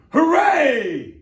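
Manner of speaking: very high, loud cheer; gleeful shout. Acted. Yertle cheering from his throne in the sky. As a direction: whoop with delight. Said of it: "shouted Yertle".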